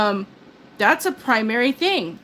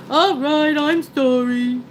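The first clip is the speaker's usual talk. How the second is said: mock sorry husband; 3 semitones higher, 3 dB louder, slower than usual. Husband voiced apologizing.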